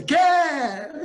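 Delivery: put-on loud, drawn-out toddler yes; Emily's eager reply. Grandfather imitating granddaughter answering any offer, eager toddler shout. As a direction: answer like an over-keen two-year-old. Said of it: "É a entonação dela".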